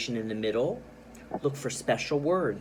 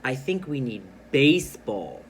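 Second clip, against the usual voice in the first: BASEBALL punched louder; stress on BASEBALL; 6 dB louder than usual. Shown as a demonstration. Teacher shows pupils funny writing, stressing "baseball" loudly. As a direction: hit the word "baseball" hard and loud. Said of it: "I'm gonna stress that word".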